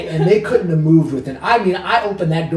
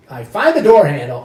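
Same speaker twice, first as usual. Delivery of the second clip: ordinary delivery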